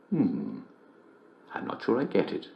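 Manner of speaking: soft, half-whispered confusion; puzzled murmur. Acted. Host puzzling over a joke on air, murmuring. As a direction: mutter it as if to yourself.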